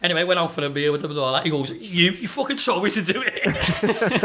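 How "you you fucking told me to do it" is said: slow, higher retold grievance; mock complaint, laughing. Singer blaming his new mate after the gig, mock complaint with laughter. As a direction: imitate blame while starting to laugh.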